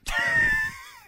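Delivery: high shriek of fright; frightened scream. Real, not acted. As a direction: shriek from the scare. Screams at scare. (grito de susto)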